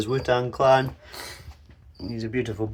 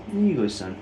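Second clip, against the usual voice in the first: usual speaking voice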